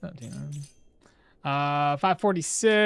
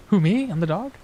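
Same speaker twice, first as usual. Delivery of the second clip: usual speaking voice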